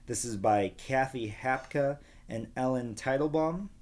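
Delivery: plain reading voice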